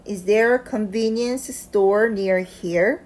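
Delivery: very slow, clear question with a rise at the end; slow model reading. Shown as a demonstration. Teacher reads the full question slowly for students to repeat. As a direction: read it slowly and carefully for learners to repeat. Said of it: "다시 한번 해볼게요".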